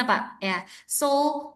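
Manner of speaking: ordinary delivery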